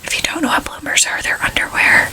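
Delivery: soft voiceless whisper to the listener; whispered aside. Real, not acted. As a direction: lean in and whisper the explanation.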